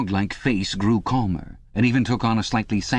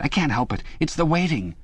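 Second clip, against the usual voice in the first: higher, strained apology; can't hold out any longer, pitched up; Parsons, desperate; 8 semitones higher than usual. Acted. Parsons apologising to Winston, higher strained voice.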